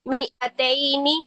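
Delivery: मिअतैनी slow, alif silent; slow careful मिअतैनी; Qur'anic recitation. Shown as a demonstration. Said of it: "इस तरीके से पढ़ेंगे".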